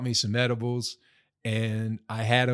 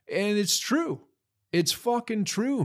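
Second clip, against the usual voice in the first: ordinary talk, nothing marked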